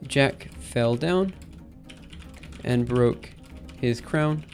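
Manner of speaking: normal talking voice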